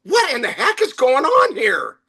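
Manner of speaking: mock panic, voice jumping high; panicked. Calm server rushing to the boss in a mild imitated panic. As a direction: burst in flustered and alarmed. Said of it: "come running down in a panic".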